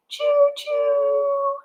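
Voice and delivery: high sing-song choo-choo; high train whistle choo-choo. Pretend. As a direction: play a happy train. Train sound in kids' book.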